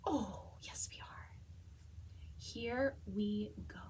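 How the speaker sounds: hushed, slow whisper; soft whisper; whispered reply. Pretend. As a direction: whisper it like a secret. Whispering answer for the dog.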